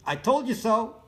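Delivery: high, loud I told you so; loud gloat. Real, not acted. Telling viewers I told you so, loud and high. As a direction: crow it at them.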